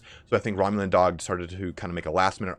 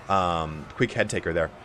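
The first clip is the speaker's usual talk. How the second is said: usual speaking voice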